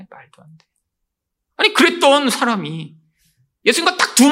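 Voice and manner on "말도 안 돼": whispered scoff. Retold or imitated. Nathanael scoffing in disbelief.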